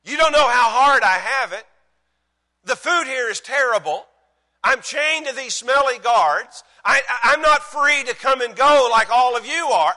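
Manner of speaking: loud, high, hammed-up self-pity; woe-is-me complaint. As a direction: moan out an imagined list of grievances. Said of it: "He could have written a letter that started out and said".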